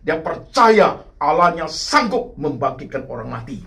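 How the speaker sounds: high, loud sermon climax with punched key words; impassioned preaching. Real, not acted. Preacher urging the congregation to progressive faith, impassioned. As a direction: preach it forcefully, voice lifted and ringing out.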